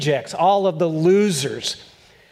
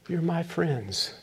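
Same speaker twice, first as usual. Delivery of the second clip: "You're my friends" much softer and slow, tender; 8 dB quieter, slower than usual. Shown as a demonstration. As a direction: let "You're my friends" sink in quietly. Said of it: "Take this home".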